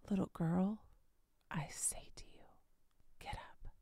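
tender hushed wake-up call; private whisper. Acted. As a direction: hush it to the child like a secret. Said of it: "He didn't scream it. He didn't yell it. It was a private, compassionate moment".

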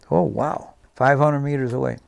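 normal talking voice

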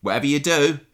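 mock urgent warning. Podcaster mock-warning Abby about the clothes, urgently.

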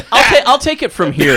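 high, loud, laughing. Real, not acted. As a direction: talk while laughing hard. Taking over story, laughing.

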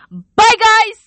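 sudden very high, loud goodbye yell; screamed goodbye; loud shouted sign-off. Real, not acted. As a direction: blast one last farewell at the listeners. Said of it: "Turn down your volumes".

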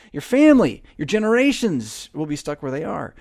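normal talking voice

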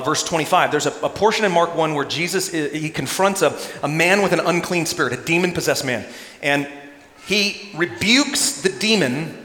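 normal talking voice

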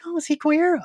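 high curious gasp; mock surprise. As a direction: lift it up in sudden wonder. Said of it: "I was like".

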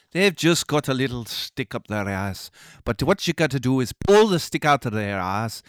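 mock Scottish motivational speech; rousing pep talk in a hammed-up Scottish accent; Obama. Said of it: "It's my best Scottish Obama".

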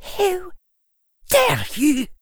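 high, loud outraged hiss; furious hiss. A narrator's reading. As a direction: spit the words in outrage. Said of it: "her voice descended into an outright hiss".

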